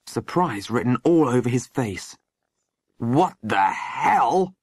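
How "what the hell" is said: slow, drawn-out astonishment; stunned gasp. A narrator's reading. Sergeant finding the boys in the room, stunned gasp. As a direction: gasp it slowly in disbelief. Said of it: "he gasped".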